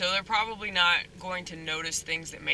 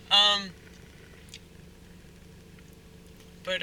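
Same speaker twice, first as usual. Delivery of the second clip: talking while chewing gum; words round a wad of gum; 5 dB louder than usual. Real, not acted. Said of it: "Sorry, I'm chewing gum".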